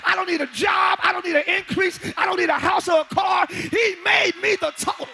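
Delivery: loud, rousing preacher; preaching at full force. Acted. Preacher tells the congregation they are the total package, loud rousing preaching. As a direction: preach it to the rafters.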